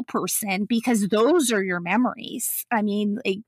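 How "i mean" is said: ordinary talk, nothing marked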